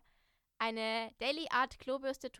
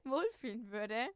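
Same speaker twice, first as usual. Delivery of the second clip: laughing; 7 semitones higher, 10 dB louder, slower than usual. Real, not acted. Laughing at feldbett remark.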